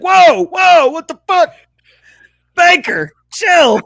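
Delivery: high, loud mock outrage; outraged Italian Americans. Host playing tracksuited Italian Americans at home, in high mock outrage. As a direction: call out in comic disbelief at the TV. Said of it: "Italian Americans who were like".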